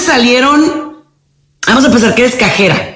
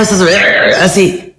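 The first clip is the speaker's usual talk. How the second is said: ordinary talk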